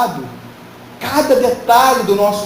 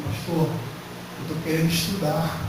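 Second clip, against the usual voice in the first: timid request; 4 semitones lower, 8 dB quieter, slower than usual. Retold or imitated. Young man asking pastor's permission.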